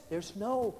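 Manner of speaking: low, hushed stern staff voice; quiet rebuke at the gym; gym staff scolding. Retold or imitated. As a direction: play the prim staff member shushing him. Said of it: "they came over and says".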